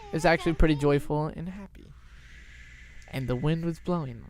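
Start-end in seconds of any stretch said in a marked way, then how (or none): none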